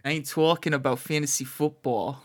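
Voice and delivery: broad New Jersey vowels on "talking"; Jersey accent. Acted. Co-host voicing the ballplayer for the host, Jersey accent. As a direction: play a Jersey guy, accent on. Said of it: "Give him some Jersey".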